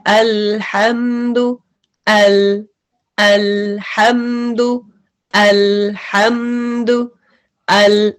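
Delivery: slow अल्हम्दु with a half-stopped ल; ल in अल slightly firm, slightly continued; middle lam. Shown as a demonstration. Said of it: "अब middle क्या है".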